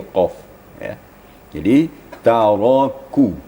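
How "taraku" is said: "taraku" slowly, thick ra, thin kaf; slow careful reading. Shown as a demonstration. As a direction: draw out "taraku" calmly. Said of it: "ini agak pelan juga bacaannya".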